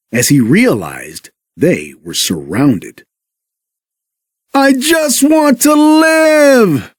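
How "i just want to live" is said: high, very slow wail of fear; terrified cry. A narrator's reading. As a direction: beg for your life, dragging it out. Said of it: "cried Sandy".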